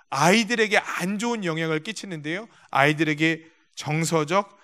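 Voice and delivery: ordinary delivery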